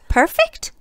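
very high, loud astonished perfect; astonished question. Acted. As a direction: shoot up in disbelief. Mr Happy amazed at word.

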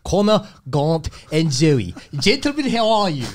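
put-on Afrikaans radio-host accent. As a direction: open the show as an Afrikaans-accented presenter. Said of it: "I'm gonna do it in an Afrikaans accent".

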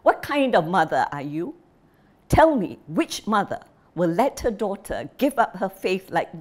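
a furious father scolding his wife; angry old father. Acted. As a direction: jab each question at her.

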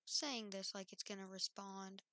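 ordinary delivery

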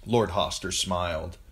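ordinary delivery

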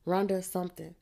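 ordinary delivery, nothing marked

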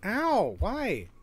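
ordinary talk, nothing marked

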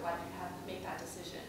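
ordinary delivery

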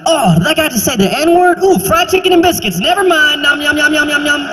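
high, giddy switch from shock to yum; food-distracted glee. Kid growing up in South Carolina, giddy food glee. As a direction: get swept away by fried chicken. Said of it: "it's kinda like".

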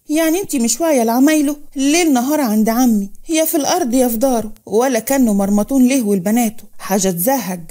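usual speaking voice

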